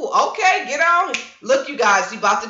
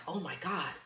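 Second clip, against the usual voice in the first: awed hush; 5 semitones lower, 17 dB quieter than usual. Retold or imitated. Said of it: "It's like".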